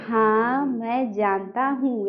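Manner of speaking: slow, soft, low drawn-out हाँ मैं जानता हूँ; wrong droning reading. Shown as a demonstration. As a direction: drag out हाँ and every word after it, quietly. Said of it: "ऐसे नहीं". (हाँ मैं जानता हूँ को धीमे, खींचकर बोलना)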